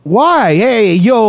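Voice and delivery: high, indignant Brooklyn street guy; mock Brooklyn accent. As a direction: ham up an offended Brooklynite. Teasing hater of Brooklyn people.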